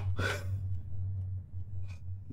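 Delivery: long, soft breathy exhale; soft, voiceless sigh of relief. Real, not acted. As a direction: let out a drawn-out sigh after a scare. Sigh after sweaty scare.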